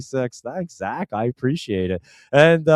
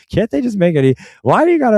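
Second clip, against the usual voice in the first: higher and louder, fed up with corporate speak; exasperated outburst; 9 semitones higher, 6 dB louder, faster than usual. Real, not acted. Host mocking the animal health company's website slogan, exasperated outburst. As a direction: throw up your hands at the slogan.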